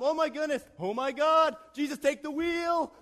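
high, loud cry for help; hammed-up desperate wail; comic panic. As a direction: plead loudly as the horse bolts. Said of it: "He doesn't know what's going on".

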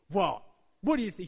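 a store clerk snapping at a customer, irritated; snapping back. Retold or imitated. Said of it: "she just snapped at me".

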